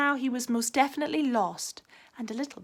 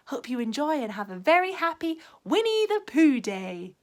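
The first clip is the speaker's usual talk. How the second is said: plain reading voice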